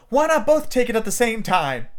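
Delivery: put-on high-pitched bright-idea girl; Wendy proposing. Daughter has a splendid idea, eager. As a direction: suggest it eagerly in a child's voice. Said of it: "Wendy had a splendid idea".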